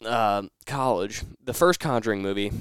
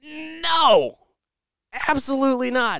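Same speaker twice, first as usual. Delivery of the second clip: much higher, squeaky panicked no, absolutely not; horrified refusal; 12 semitones higher, slower than usual. Retold or imitated. Told the horror movie plan, he refuses in high panic. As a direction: recoil in horror at the movie pick. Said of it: "And I was like".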